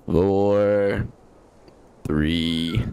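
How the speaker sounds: obnoxiously deep, drawn-out countdown; Markiplier. Acted. Streamer counting down to opening a gift, fake deep voice. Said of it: "This is my Markiplier impression".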